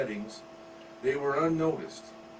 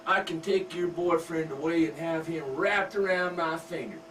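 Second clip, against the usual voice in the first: higher, smug taunting country mom; mother taunting her daughter, smug and higher; 5 semitones higher, 3 dB louder than usual. Acted.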